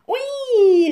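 very high, loud startled squeal; startled cry. A narrator's reading. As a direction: cry out in fright as a girl. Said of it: "rief das Mädchen erschrocken". (erschrockener, hoher Ausruf)

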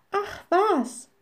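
high, dismissive exclamation; dismissive cry. A narrator's reading. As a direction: brush it off as the little witch. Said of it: "rief die kleine Hexe".

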